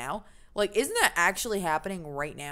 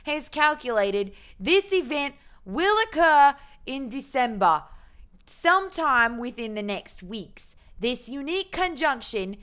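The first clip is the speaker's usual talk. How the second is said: hammed-up British-Australian accent, pitched higher; terrible Australian-British accent; 4 semitones higher, 4 dB louder than usual. Streamer reading about the conjunction, terrible Australian-British accent. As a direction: proclaim it in a badly faked British accent. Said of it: "half terrible Australian, half terrible British".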